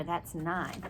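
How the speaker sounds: usual speaking voice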